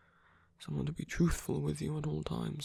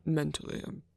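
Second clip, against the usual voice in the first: ordinary talk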